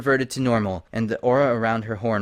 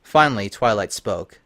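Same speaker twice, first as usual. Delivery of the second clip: plain reading voice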